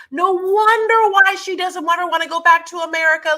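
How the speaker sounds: squealing it out in a different voice; mock incredulous. Co-host mocking Yara's envy of Europe, high and loud. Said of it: "I was like".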